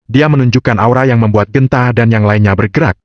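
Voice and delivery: plain reading voice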